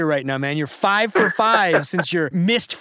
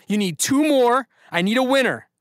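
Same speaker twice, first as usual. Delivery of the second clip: hyped up; 8 semitones higher, 3 dB louder than usual. Real, not acted. Host hyped after correct answer.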